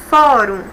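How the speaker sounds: Fórum with the first syllable FÓ punched; stress on FÓ. Shown as a demonstration. Teacher to students, showing where Fórum's stress falls. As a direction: hit FÓ in Fórum, let -rum fall away. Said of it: "a penúltima sílaba é a mais forte quando eu pronuncio".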